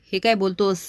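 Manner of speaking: ordinary delivery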